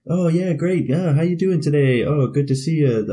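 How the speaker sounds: high, bright chirpy small talk; polite Canadian greeting. Retold or imitated. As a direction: imitate an overly friendly cashier. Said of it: "everybody's saying sorry, super polite".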